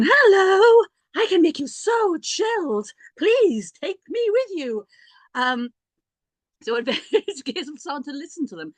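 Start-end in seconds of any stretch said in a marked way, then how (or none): 0.0-4.9 very high, slow, coaxing little plant voice; sweet talking lavender. Pretend. Lavender plant tempting her to take it home, high and slow. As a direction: coo like a plant offering to soothe her. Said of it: "lavender was also going".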